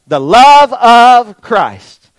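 loud, high and drawn out; called-out answer. Shown as a demonstration. Preacher leading the congregation to shout it, loud slow call. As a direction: shout it slowly, stretching each word.

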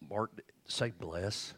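slow, soft, calm voice of God; God's quiet command. Retold or imitated. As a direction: sprich langsam und leise wie Gott, ruhig befehlend. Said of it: "And God says".

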